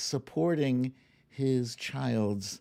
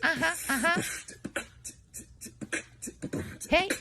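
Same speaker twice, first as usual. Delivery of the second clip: put-on very high, cute little girl voice. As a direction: squeak it like a little kid. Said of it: "she's like".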